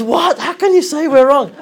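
high, loud imitation of an indignant woman; mock outrage. Woman told her belief is wrong, retold in mock outrage. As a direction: voice shoots up in acted disbelief.

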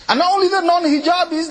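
usual speaking voice

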